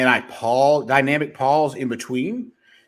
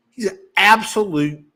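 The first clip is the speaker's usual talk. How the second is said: usual speaking voice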